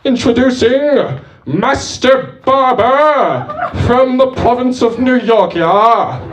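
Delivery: high, loud, drawn-out herald's introduction; minstrel announcer. Acted. Minstrel introduces the barber to a crowd, high ringing proclamation. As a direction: proclaim it like a minstrel, stretching the names. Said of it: "the kind of thing minstrels would introduce".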